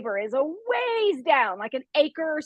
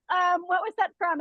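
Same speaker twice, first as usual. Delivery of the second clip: ordinary talk, nothing marked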